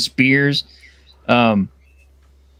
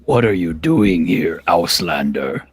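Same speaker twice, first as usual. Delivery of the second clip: gruff orc; 9 semitones lower than usual. Acted. Orc challenges the party.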